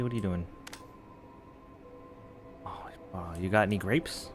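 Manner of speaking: usual speaking voice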